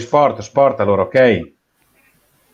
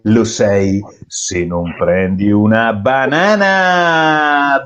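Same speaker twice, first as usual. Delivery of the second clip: usual speaking voice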